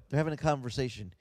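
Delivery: normal talking voice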